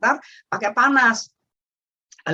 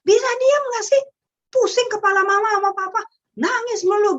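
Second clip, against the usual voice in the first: high, loud mock scolding of a crying child; exasperated parent yelling; 11 semitones higher, 5 dB louder than usual. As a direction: shriek it like a fed-up mom. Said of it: "ada orang tua yang berkata gini". (bentakan orang tua yang kesal, tinggi dan keras)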